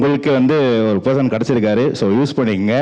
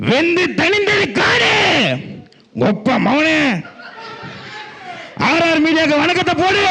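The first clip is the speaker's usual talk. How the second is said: swaggering movie one liner; slower than usual. Acted. Punch dialogue from a film.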